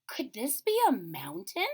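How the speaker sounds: high, awed little bear; little bear voice full of wonder. Acted. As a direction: marvel at the huge climb. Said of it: "he wondered".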